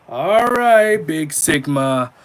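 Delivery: loud, high teasing cheer, slowing on the name; mock hype. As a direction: hype him up sarcastically. Mocking the commenter.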